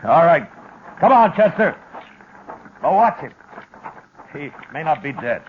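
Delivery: loud, high urgent call. Acted. The Marshal calls Chester forward to watch Prent, loud high shout. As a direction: shout the order across the yard.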